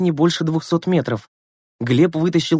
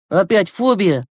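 annoyed; 6 semitones higher, slower than usual. Acted. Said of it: "с досадой спросил Глеб".

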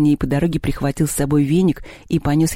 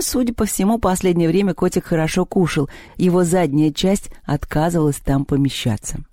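ordinary delivery

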